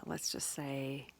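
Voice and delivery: ordinary delivery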